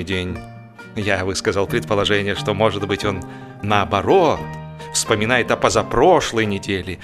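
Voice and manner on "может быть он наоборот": ordinary delivery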